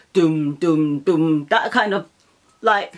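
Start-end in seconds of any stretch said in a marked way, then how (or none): 0.1-1.5 low "doom, doom, doom" bass thumps; imitated beat. Showing how the track turned dance, imitated beat. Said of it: "then it was like".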